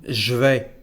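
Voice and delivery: "je vais" said zh-vay, no E; contracted je. Shown as a demonstration. Teacher showing the second contraction, "je vais" said as zh-vay. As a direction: buzz zh straight into "vais". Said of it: "The second one".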